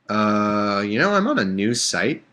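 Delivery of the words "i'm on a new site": ordinary talk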